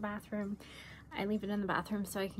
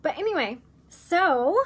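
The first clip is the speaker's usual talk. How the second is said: louder higher bright switch; upbeat; 6 semitones higher, 12 dB louder than usual. Real, not acted. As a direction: snap back to the cheery outro. Bright reset to outro.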